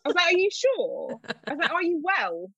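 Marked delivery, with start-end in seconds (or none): 0.3-1.2 high-pitched, incredulous question; retold disbelief. Retold reaction to winning news. 1.7-2.5 high-pitched doubting question; retold disbelief. Retold reaction to winning news.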